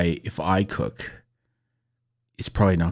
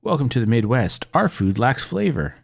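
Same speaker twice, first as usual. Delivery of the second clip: higher, louder, slowing promo voice; mock cheerful slogan, slowing at the end; mock tourism slogan; 5 semitones higher, 4 dB louder than usual. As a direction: sell it like a cheesy welcome sign. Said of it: "That could be, like, their calling card".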